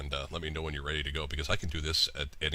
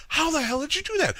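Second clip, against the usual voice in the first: very high, loud amazement; amazed retold outburst; 6 dB louder than usual. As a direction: act out his utter bafflement.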